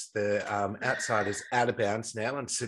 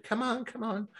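high-pitched cat coaxing. Retold or imitated. Owner urging her stuck cat across, high coaxing. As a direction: re-enact urging a pet along.

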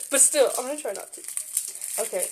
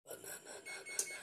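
playful whisper; 19 dB quieter than usual. Real, not acted. Whispering mock chant.

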